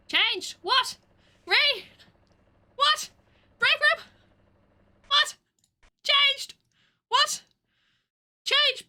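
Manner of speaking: frantic high shouted callouts; yelling callouts. Real, not acted. Friends in a game yelling changes, frantic shouting. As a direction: shout it across the room at full volume. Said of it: "have people scream at me".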